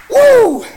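high loud whoop; joyful woo shout. Real, not acted. Preacher whooping in joy to the church. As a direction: let out an ecstatic woo.

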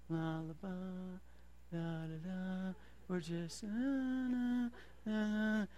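sleepy mumbling. Pretend. Mocking lazy Christians drifting through life, drowsy mumble. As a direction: drift off, mumbling la la as if half asleep.

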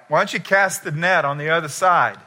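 acted stranger's shout; yelling out. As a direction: call it loudly across the water. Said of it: "yells out".

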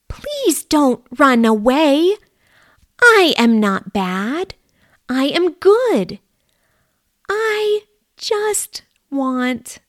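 a small mouse begging not to be feared; pleading mouse. Acted. Mouse begging Ed not to run, high pleading.

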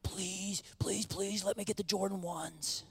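put-on breathy whispered please, like a kid pleading; childish begging. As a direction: beg softly like a little boy wanting sneakers. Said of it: "I was begging my mom and dad".

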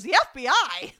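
a loud, high retold gasp of alarm; mock alarmed. A spy echoes the word with comic disbelief, loud and high.

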